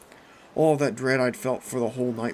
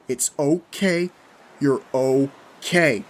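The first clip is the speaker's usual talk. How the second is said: loud, very slow, stretched-out words; calm her down firmly, over the noise; insistent reassurance; 3 semitones higher, 9 dB louder, much slower than usual. A narrator's reading. Narrator calming screaming Kathy, loudly insistent.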